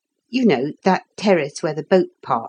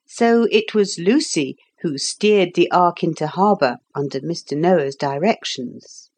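plain reading voice

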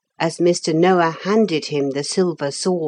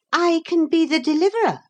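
high, quick, thin claim; thin, disagreeable voice; 13 semitones higher, faster than usual. A narrator's reading. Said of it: "a thin and disagreeable voice".

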